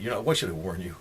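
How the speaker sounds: usual speaking voice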